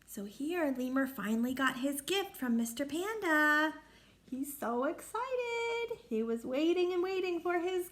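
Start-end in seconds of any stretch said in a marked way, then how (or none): none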